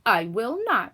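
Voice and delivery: the witch refusing, mildly voiced; retort. A narrator's reading. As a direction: snap back as the witch. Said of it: "retorted the witch".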